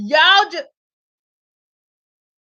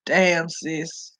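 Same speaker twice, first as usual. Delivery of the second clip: low disbelief; 9 semitones lower, 7 dB quieter, much slower than usual. Retold or imitated. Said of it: "I had to be like".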